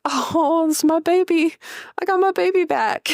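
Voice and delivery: put-on high, cooing baby-talk delight; squealing proud mother, high and sweet; gushing mom voice. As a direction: coo it like you got your baby back. Mother gushing over her baby.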